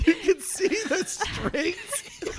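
high, laughing mock disbelief at the strings. Real, not acted. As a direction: laugh through it, incredulous.

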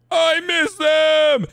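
high drawn-out wail, slow; wailing cry. Retold or imitated. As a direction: wail it like a homesick kid. Acting out camp goodbye cry.

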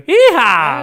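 a light imitation of the Texan from The Simpsons; lightly done cartoon Texan whoop. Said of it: "au texan dans Les Simpsons".

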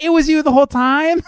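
high-pitched incredulous kid imitation; mock astonishment. Host acts out son unmasking his dad, mock astonished. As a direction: voice a boy's amazed realization, rising high.

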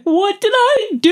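put-on high, loud panicked child's cry; scared kid's plea. Child being whipped by demon, high panicked plea. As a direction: plead like a terrified little boy. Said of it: "And you're like".

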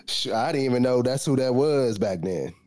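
ordinary talk

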